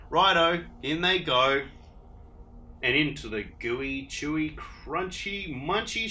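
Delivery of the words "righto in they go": louder cheery dingo; eager agreement; cheerful Dingo. Acted. Dingo agrees with Echidna, loud and cheery.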